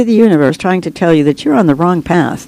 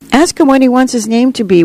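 put-on higher-pitched child's demand, mildly impatient; 7 semitones higher than usual. A narrator's reading. Child to the silent narrator, waiting for the dove's answer, impatient demand. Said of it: "one of the children demanded, getting impatient".

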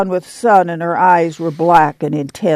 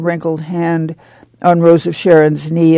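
usual speaking voice

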